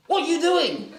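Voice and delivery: imitated high-pitched, indignant yell; mock scolding, pitch shooting up; indignant outburst. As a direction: act out an outraged demand. Father's outburst at bag acted out.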